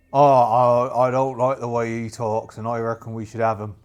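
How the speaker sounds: put-on pirate crewman, loud opening; crewman's voice egging the captain on; disgruntled crewman. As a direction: object loudly, then settle into grumbling. Crewman Staines voiced, wants fight.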